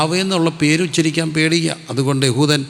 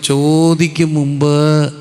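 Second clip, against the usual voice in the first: ചോദിക്കും മുമ്പ് drawn out slowly, louder, each word weighted; slow emphatic verse; 5 dB louder, much slower than usual. Shown as a demonstration. Preacher hammering the verse to the congregation, slow and loud.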